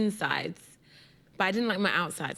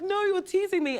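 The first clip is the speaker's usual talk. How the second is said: put-on high, whiny little-kid protest; 11 semitones higher than usual. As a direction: protest like a child who feels picked on. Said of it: "And I'm like".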